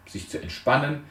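ordinary delivery

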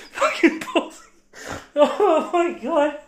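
high, slowed by laughter; laughing fit. Real, not acted. Reacting to a co-host's story, laughing hard. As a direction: lose it completely laughing. Cracking up over the tweet.

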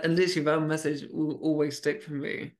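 normal talking voice

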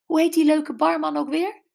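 high, loud, urgent question; urgent hiss. A narrator's reading. Brenda urgently asking Jules about the barman, hissing. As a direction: ask it urgently, pitched well up. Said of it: "siste ze naar Jules".

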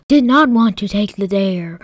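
plain reading voice

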